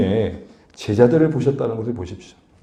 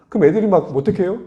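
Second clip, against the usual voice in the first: ordinary delivery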